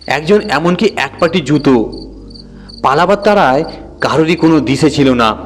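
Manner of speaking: plain reading voice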